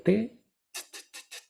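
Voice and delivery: t as bare voiceless puffs, repeated; T sin vibración. Shown as a demonstration. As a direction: tap out t with no voice at all. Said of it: "t, t, no vibra". (t sorda, solo aire, repetida)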